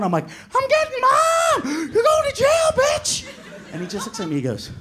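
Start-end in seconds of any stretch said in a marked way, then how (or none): 0.2-1.6 very high, drawn-out shriek; mock hysterical threat. Teller screaming threat at brother. 1.9-3.3 very high shouting; hammed-up squealed accusation; mock hysterical threat. Teller screaming threat at brother.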